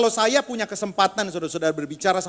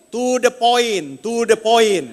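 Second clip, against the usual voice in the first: very slow, louder, each word laid down; slow blunt demand; 2 semitones higher, 7 dB louder, much slower than usual. Retold or imitated. Husband cutting his rambling wife short: slow, loud and blunt. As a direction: cut her short, slowly and firmly. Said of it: "saya langsung ngomong begini".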